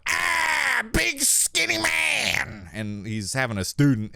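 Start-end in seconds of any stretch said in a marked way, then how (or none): 0.1-2.7 high, slow, stretched scared yell; mock scream. Gamer retells yelling at a monster near dad's student. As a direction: wail it like you've seen the monster. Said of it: "I'm obviously going".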